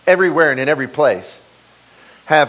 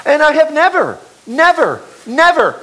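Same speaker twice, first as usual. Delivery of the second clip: never stressed hard each time, higher and louder; repeated never; 11 semitones higher, 5 dB louder than usual. Shown as a demonstration. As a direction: hammer never three times. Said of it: "not one time, not once".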